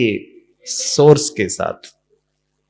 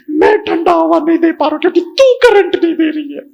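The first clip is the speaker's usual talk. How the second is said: high, loud mock complaint; complaining fan; 6 dB louder than usual. Husband fan blaming his wife, high mock whine. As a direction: blame her in a squeaky whine. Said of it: "पंखा हूं".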